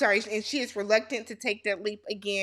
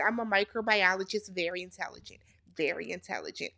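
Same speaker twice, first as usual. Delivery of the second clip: low, hushed mimicry, trailing softer; mock self-important boast; 4 semitones lower, 7 dB quieter, faster than usual. Recapper mimicking Destiny bragging about brains, mock self-importance. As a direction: imitate a boaster, dropping low and quiet.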